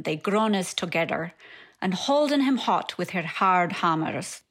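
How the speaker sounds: reading a poem aloud in Middle English pronunciation. Shown as a demonstration. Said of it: "so I'll read it in Middle English first".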